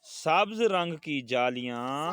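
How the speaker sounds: slow classroom prompt, rising at the end; measured words for the class to echo; slow model reading. Shown as a demonstration. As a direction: read slowly, leaving it open for pupils. Reads sentence for class to repeat.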